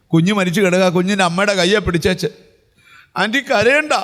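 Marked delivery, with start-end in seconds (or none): none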